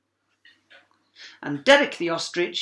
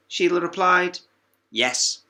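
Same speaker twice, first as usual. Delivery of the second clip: ordinary delivery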